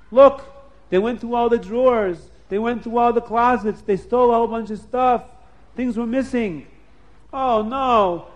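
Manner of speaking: upset man finding his home robbed; shocked Lavan. Acted. Lavan finds his house robbed.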